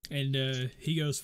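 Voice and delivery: ordinary talk, nothing marked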